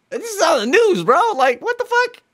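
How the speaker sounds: high-pitched incredulous squeal; mock disbelief. Host retells seeing it on the news, squeaky mock disbelief.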